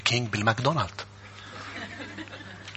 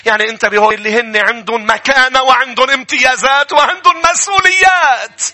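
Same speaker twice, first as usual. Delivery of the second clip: a preacher shouting holiness at the church; full-force preaching; 11 semitones higher, 8 dB louder than usual. Real, not acted. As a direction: thunder it from the pulpit. Sermon climax on responsibilities.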